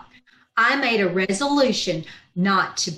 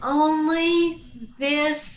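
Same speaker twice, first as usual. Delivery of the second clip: flustered stammer; 4 semitones higher, much slower than usual. Acted. Flustered, stammering under flirting.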